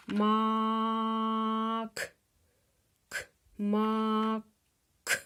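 Мак, then к split off clearly on its own; separating the sounds. Shown as a demonstration. Teacher to pupils isolating the last sound of мак, clearly sounded out. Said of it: "Третій звук у нас".

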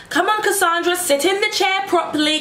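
high, bossy nurse telling her off; imitating the midwife scolding her. Midwife telling Cassandra to sit properly, high mock scolding. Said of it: "There's another lady, and she's just like".

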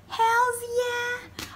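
high, drawn-out whoop of agreement; excited cheer. Real, not acted. Enthusiastic answer to question.

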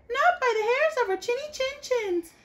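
very high squeaky refusal; little pig refusing the wolf, high and defiant. A narrator's reading. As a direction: squeak back bravely. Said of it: "the little pigs replied".

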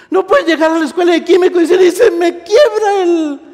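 high, loud outcry breaking into laughter; loud mock excuse. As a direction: shout a student's silly worry, high-pitched. Preacher mocks students' excuses, loudly.

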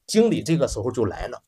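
ordinary delivery, nothing marked

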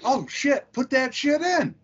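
high-pitched eager outburst; all excited. Retold or imitated. Crewmate hears there is a movie to watch, excited. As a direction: relive the excitement, pitch climbing through the line. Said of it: "I was all excited".

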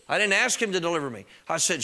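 ordinary talk

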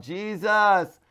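high pleading cry, slowed down. Shown as a demonstration. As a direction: beg for mercy out loud. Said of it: "praying and crying out".

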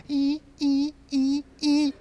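high piercing imitated violin stabs; shrieking horror score imitation; horror music screech. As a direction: screech like slasher strings. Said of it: "it goes".

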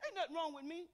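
normal talking voice

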